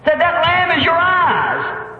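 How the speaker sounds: high, rising protest, drawn out at the end; challenging priest. Retold or imitated. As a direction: challenge him as the priest, building to a shout. Priest challenges blind man in story.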